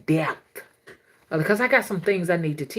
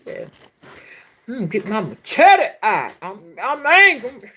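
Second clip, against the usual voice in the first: mock angry; 3 dB louder than usual. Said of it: "whereas I might just feel like".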